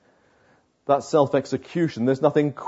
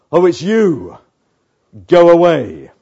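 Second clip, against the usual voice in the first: flat "oh it's you", then a louder curt "go away"; dismissive brush-off; 5 dB louder than usual. Pretend. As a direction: shut the door on an unwanted caller. Repeating the door illustration.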